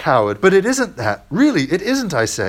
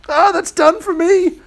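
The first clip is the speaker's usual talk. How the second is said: high, breathless gasp of pain; 5 dB louder than usual. Acted. Ghost reeling back after the lizard is killed, gasping. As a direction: gasp it out, pitched way up. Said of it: "gasped the ghost".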